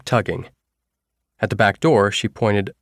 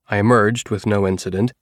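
usual speaking voice

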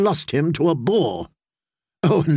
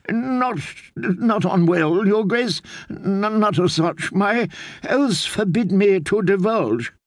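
higher, stammering plea; frightened old maester; 6 semitones higher than usual. A narrator's reading. Old maester pleading loyalty to the queen, higher and afraid. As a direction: swear loyalty while shaking with fear. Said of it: "old man plucked at his beard".

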